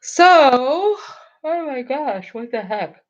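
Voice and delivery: words stretched out through a big yawn. Real, not acted. Late-night streamer yawning while chatting to viewers. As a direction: talk right through the yawn. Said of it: "I don't know why I'm yawning so much".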